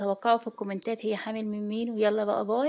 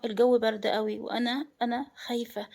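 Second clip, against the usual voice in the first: ordinary delivery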